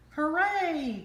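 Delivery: high, loud cheer. A narrator's reading. Gray Mouse cheering for the new family, high and loud. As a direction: cheer for the happy ending. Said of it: "cheered Gray Mouse".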